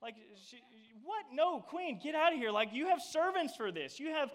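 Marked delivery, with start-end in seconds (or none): 1.3-4.3 higher and louder, shooing the Queen off; a mock yell telling the Queen to leave; loud mock protest. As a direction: cry out in pretend outrage. Pretend shout at the Queen.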